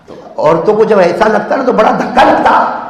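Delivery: usual speaking voice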